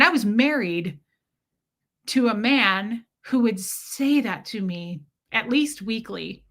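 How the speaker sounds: usual speaking voice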